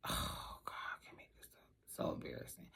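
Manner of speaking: hushed, embarrassed whisper. Real, not acted. Confiding to viewers, in an embarrassed whisper. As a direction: breathe it out, mortified.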